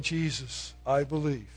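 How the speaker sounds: soft, low spoken prayer; quiet prayer. Shown as a demonstration. Preacher modelling a humble prayer, soft and low. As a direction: pray it softly and humbly. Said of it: "by falling on your knees".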